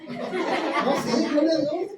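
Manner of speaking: chuckling through the words; laughing. Real, not acted. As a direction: laugh it off at yourself. Preacher laughs at himself.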